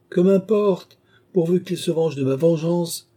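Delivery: high-pitched, forceful dismissal; defiant scorn. Acted. Countess dismisses the threat of revenge, defiant. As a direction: brush off the threat loudly and defiantly.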